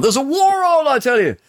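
very high hammed-up cry of "There's a war on"; shrill-voiced parody of a wartime alarmist; mock alarmist. Host parodying a panicked wartime voice, very high.

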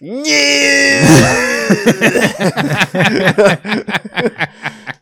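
long high-pitched put-on wail breaking into laughter; awful mock French wail. Co-host asks what it sounded like, hammed-up French wail. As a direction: wail it out as bad French acting, then crack up. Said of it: "It sounded like what, Cookie?".

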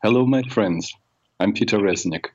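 ordinary delivery

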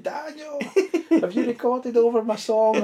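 high, loud scolding mum; mum's voice. Retold or imitated. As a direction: voice the mum calling Daniel. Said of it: "my mum was like".

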